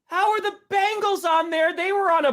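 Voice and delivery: incredulous. Real, not acted. Indignant question.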